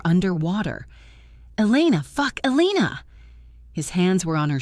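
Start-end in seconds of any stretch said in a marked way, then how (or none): 1.6-3.1 high, urgent calling out; panicked, call her back to you; frantic calls to a fainting partner; calling her name. A narrator's reading. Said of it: "Minho was calling her name".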